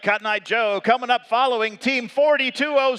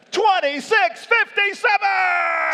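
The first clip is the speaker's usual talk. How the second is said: shouting; 8 semitones higher than usual. Real, not acted. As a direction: belt the team number out. Emcee calls out the team.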